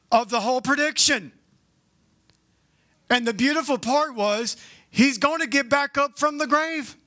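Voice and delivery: preacher's rising excitement; rising preaching fervor. Real, not acted. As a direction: lift it up, marvel at it. Preacher lifting to the climax.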